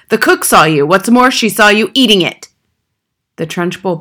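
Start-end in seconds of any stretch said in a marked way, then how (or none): 0.1-2.5 loud accusation, climbing in pitch; Trunchbull's bark. A narrator's reading. Headmistress confronts boy with a witness, barking. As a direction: drive the evidence home at a bellow. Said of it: "barked the Trunchbull".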